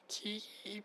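long, high "kii" squeal; high-pitched cry imitation. Describing a Tahitian dance shout to a friend, high imitated cry. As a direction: imitate a piercing dance call, drawn out. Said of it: "高い声でさ".

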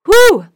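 loud high exhale after choking; loud relieved whew. Real, not acted. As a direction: blow out hard after coughing. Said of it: "sucked that down the wrong pipe".

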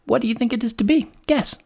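fast, high bird voice; excited lark, rising to a high pitch; teasing lark. Acted. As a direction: toss out a quick high riddle.

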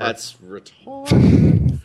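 slow, loud disbelief. Real, not acted. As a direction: drag it out loudly in disbelief. Reacting to huge number.

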